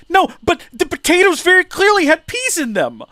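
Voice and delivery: high, loud exasperated squeal; baffled protest. Retold or imitated. A kid insisting to his parents, high exasperated squeal. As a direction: plead the obvious in a squeaky outrage.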